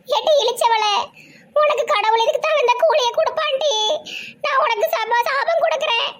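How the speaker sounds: put-on high, vengeful curse of a wronged old woman; mock curse. Shaved old woman curses the family, high spiteful curse. Bald grandma curses everyone.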